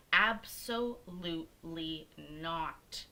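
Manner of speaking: "Absolutely" broken into slow, separate syllables; emphatic refusal. Retold or imitated. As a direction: draw out "Absolutely not", one beat per syllable. Acted refusal of nurse's attitude.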